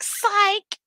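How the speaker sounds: very high gleeful shout of psych; spring the prank with glee. Retold or imitated. Kid gloating after fake sad story.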